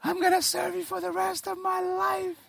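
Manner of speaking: high pleading bargaining voice; desperate panic prayer. Retold or imitated. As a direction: beg God in a panic, pitched high. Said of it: "How many of you guys have prayed".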